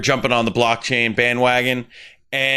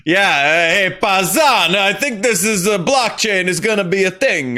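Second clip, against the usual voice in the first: bad Italian New Jersey accent; 7 semitones higher than usual. Mocking New Jersey officials, hammed-up Italian New Jersey accent. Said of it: "that was a really bad Italian New Jersey".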